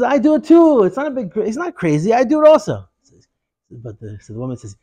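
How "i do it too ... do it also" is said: high, emphatic joke-telling Rebbe voice; protesting Rebbe. Acted. Rebbe in joke protests he's sane.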